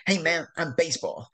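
a cheeky swaggering challenge; cocky little baseball guy. Retold or imitated. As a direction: imitate the little fella strutting and shoving. Little baseball player pushing people.

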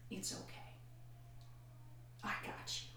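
soft, unvoiced reassurance; God comforting. God reassuring the broken wife, soft and gentle.

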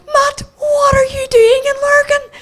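very high, loud, slow disbelief; astonished question. Retold or imitated. Woman meeting Matt in Lurgan, exaggerated astonishment. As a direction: squeal it out in mock astonishment.

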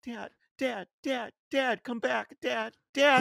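high-pitched imitation of a frightened daughter; retold panicky calling for Dad; scared child. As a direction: plead repeatedly in a high, mock-panicked child voice. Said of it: "She was so scared".

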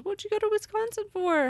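high, eager imitated listener question. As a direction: squeak it out like an impatient fan. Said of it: "I know you're all biting your fingernails".

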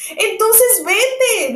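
high, slow, stern bird voice; severe pigeon. A narrator's reading. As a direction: order her away sternly. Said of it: "dijo la paloma severamente".

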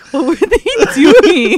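high, loud, drawn-out question through a laugh; incredulous, cracking up on the last word; laughing disbelief. Retold or imitated. Recapping own shock at the scene to a friend, laughing disbelief. Acting out own baffled reaction.

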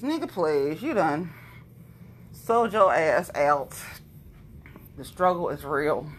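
ordinary talk, nothing marked

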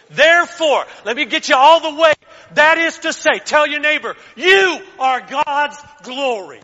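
loud, high, fired-up preaching; preaching at full force. Acted. Pastor rousing the church to embody God's glory, full-force preaching. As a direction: drive it home to the congregation.

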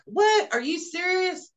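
high, incredulous "What? Are you serious?"; mock astonishment, voice jumping high; shocked disbelief. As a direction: act out a mother's stunned disbelief. Retold mother's shocked reply.

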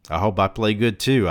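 mild mocking imitation of a dim teammate; mocking dumb voice. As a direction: throw his voice back at him. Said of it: "mocking the sound of his voice".

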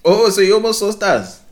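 high, loud teasing laugh; amused squeal of laughter. Real, not acted. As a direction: burst out laughing at the news.

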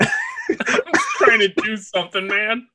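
mock him in a silly squeak while cracking up; squeaky laughing mockery. Cohost laughing while mimicking the cowardly heel's line, squeaky.

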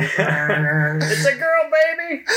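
mock-cheer the reveal through laughter; mock announcement. Cohost mock-announcing a gender reveal, laughing high and slow.

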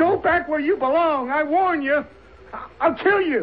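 high, loud, desperate yelling; frantic threat. Acted. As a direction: scream the warning, losing control. Carter threatens, voice rising wildly.

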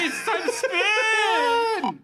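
loud, slow, high announcer shout; game show announcer. Acted. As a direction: drag it out like a host. Announcing the wheel spin.

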